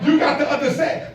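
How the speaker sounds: usual speaking voice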